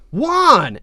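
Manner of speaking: high, loud relieved cry; exclaiming. Acted. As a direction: call his name out in relief. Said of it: "Aang exclaimed".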